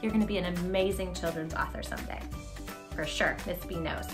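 ordinary delivery

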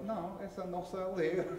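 a younger brother quickly cutting in; little brother's protest. Acted. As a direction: blurt it out quickly like a small kid. Little brother contradicts older one.

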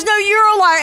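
put-on kid yelling back. Six-year-old shouting back at his mom, mock yell. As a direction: throw it back like a stubborn kid. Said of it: "And he goes".

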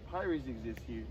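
usual speaking voice

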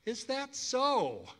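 high, slow, sneering put-on voice; an arrogant Pharaoh's slow sneer; Pharaoh's retort. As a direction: drag it out like a mocking king. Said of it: "retorted Pharaoh".

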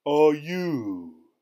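a giant roaring at a little intruder; thundering giant. A narrator's reading. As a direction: thunder it down at the tiny man. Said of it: "thundered the giant".

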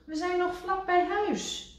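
high, exasperated voice; Rad exasperated. A narrator's reading. Rad scolding Kikker, high and exasperated. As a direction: throw up your hands.

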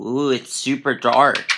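ordinary talk

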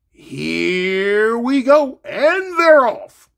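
very slow, stretched mock race call; drawn-out announcer send-off.

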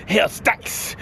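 breathy, menacing whisper. Acted. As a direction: whisper it again like a threat. Repeated whisper.

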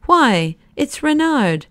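high, surprised exclamation; startled outburst, pitch leaping up; astonished cry. A narrator's reading. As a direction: cry out in sudden recognition. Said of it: "cried out".

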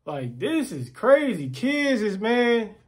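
ordinary delivery

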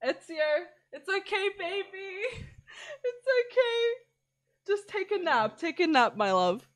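soft, tender lullaby tone; soothing. Real, not acted. Soothing the baby character.

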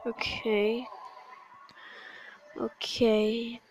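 unvoiced, soft okays; quiet self-reassurance before a retry; whispered calm-down. Real, not acted. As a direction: breathe it to steady yourself. Calming himself mid fight.

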